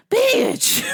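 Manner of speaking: high, punched insult; high mock-furious outburst; exasperated yell. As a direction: shout it after slamming the phone down. Yells after hanging up on caller.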